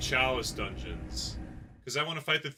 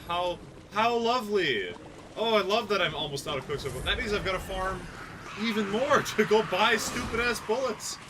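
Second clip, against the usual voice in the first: sarcastic cheer; 6 semitones higher, 3 dB louder than usual. Pretend. Sarcasm about farming.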